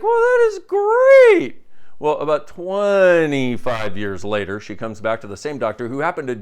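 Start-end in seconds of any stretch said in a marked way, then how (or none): 0.0-1.6 high, slow, much louder sing-song; mock delighted lady. Facelift patient pleased with doctor, high slow delight. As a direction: stretch it out like a thrilled lady. Joke punchline, woman's reaction.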